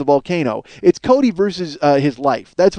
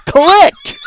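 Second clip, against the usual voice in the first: mock phone click; 14 semitones higher, 6 dB louder than usual. Phone slammed down.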